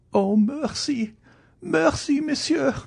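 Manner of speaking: very high, drawn-out plea; desperate, climbing high-pitched cries; begging for mercy. Acted. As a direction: wail for mercy in a woman's high voice. Wife begs for mercy.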